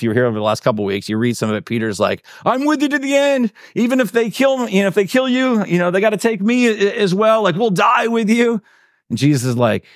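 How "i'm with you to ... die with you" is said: high-pitched, overeager pledge of loyalty; hammed-up eager devotion, pitched way up; brash vow. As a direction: play a gung-ho follower swearing loyalty. Peter's brash vow acted out.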